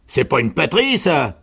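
loud, high objection; protesting sergeant. A narrator's reading. (protestation forte du sergent)

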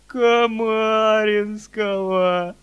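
long drawn-out tearful wail; cracking tearful heartbreak. A narrator's reading. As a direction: howl it through sobs, slowly. Boy wails in tears.

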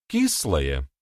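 hope he says «Кислое» is true; hopeful question. Acted. A woman hopefully asks the blacksmith: hopeful question. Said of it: "С такой же надеждой".

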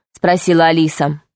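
ordinary delivery

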